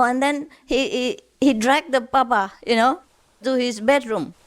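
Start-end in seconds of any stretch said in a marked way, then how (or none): none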